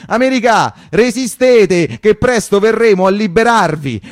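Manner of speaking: loud, slow, grand mock slogan; mock proclamation. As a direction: declaim it like a pompous war banner. Reading a mocking wall slogan.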